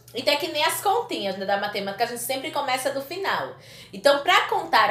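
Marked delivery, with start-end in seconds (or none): none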